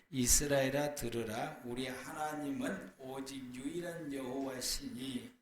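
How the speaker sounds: ordinary talk, nothing marked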